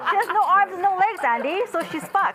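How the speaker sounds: high, loud, through real laughter; laughing. Real, not acted. Joke teller explains punchline to Andy, laughing.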